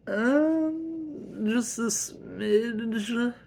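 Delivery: very high, drawn-out squeak; stall, then minimise guiltily; sheepish admission. Acted. Husband confessing overspending to his wife, sheepish.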